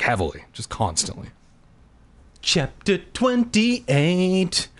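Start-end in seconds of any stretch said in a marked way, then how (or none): 2.4-4.7 slow, high, drawn-out heading; chapter announcement. A narrator's reading. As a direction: announce it with mock grandeur.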